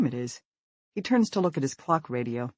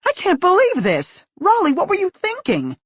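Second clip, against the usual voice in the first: much higher, loud outraged cry; outraged outburst; 9 dB louder than usual. Acted. Mother erupts at her son's burglary, high and outraged. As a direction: explode in disbelief.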